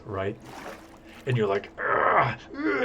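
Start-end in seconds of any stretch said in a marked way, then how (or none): 1.8-2.8 higher, louder hammed-up straining grunts; mock grunting. Gamer straining over keys, hammed-up mock grunts. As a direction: grunt like lifting something heavy, playfully. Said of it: "you're grunting and grinding".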